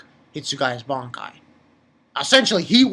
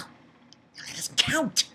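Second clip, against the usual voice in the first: whisper; 9 semitones higher, 6 dB quieter, much slower than usual. Real, not acted. Mock dismissal of a fight.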